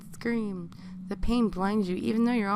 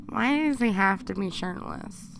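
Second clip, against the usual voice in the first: ordinary delivery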